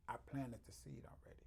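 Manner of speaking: breathy whispered aside. Real, not acted. To the interviewer, a hushed whisper. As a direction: let it slip out as a secret.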